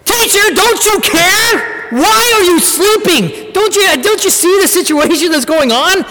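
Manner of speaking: apostles crying out to sleeping Jesus, desperate; desperate prayer. Shown as a demonstration. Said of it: "there's a desperation in their prayer".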